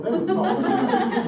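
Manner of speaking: laughing hint of a Southern country accent. Mocking French colonists, light laughing Southern country accent. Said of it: "Again, country accent".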